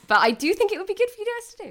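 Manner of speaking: high, fast and loud, pushing the idea; emphatic push. Real, not acted. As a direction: sell the idea hard. Repeats pitch, second take different.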